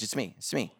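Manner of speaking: soft, hushed reassurance; gently calm scared friends; Jesus gently soothing scared disciples; reassuring. Retold or imitated. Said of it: "he's reassuring them".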